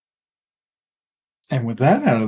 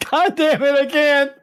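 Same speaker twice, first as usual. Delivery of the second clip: breaking into laughter; cracking up, laughing too hard. Real, not acted. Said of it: "Say it with a straight face".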